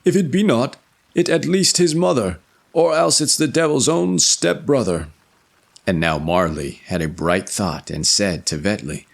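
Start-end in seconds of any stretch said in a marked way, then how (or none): 0.0-5.1 high, louder storybook voice; high character voice. Acted. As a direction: voice him high and eager. Jergli's reply in tale.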